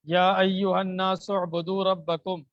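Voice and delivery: slow chanted Quranic recitation; slow Arabic recitation. Shown as a demonstration. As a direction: recite slowly and fully, like tilawat. Teacher recites verse slowly.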